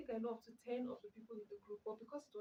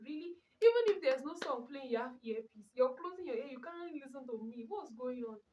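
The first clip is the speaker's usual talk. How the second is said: raised, exasperated protest; exasperated outburst; 2 semitones higher, 7 dB louder than usual. Real, not acted. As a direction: scold a friend who won't listen. Scolding friend with earpieces.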